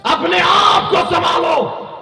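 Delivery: high, drawn-out shouted exhortation; full-voiced high preaching shout; preacher's shout. Real, not acted. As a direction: cry out a slow, soaring warning to the crowd.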